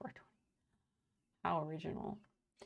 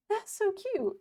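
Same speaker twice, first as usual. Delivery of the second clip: gushing; 14 semitones higher, 6 dB louder than usual. Real, not acted. Reacting to a viewer message.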